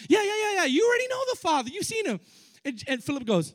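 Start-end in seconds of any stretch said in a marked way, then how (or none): 0.1-2.2 playful Jesus. Acted. Jesus answering Philip, acted.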